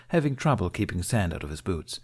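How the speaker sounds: usual speaking voice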